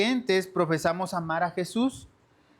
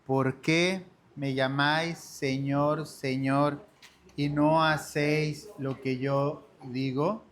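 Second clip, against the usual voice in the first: lectura lenta y enfática; 3 semitones lower, slower than usual. Shown as a demonstration. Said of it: "Qué dice, hermanos".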